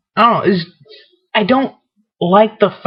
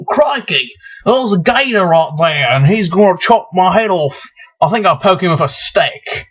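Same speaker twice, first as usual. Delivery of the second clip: hammed-up Aussie wildlife-man voice; mock Australian accent. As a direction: play up a badly faked Australian accent. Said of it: "that's an Australian accent right there".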